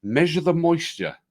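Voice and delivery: loud, bright host welcome to open the episode; big podcast opener; upbeat show intro. Acted. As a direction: announce the show like a presenter.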